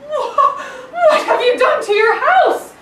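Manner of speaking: loud, high shout breaking into a laugh; loud mock outrage with a laugh. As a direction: yell it in outrage, laughing through it. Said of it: "they shouted at the man".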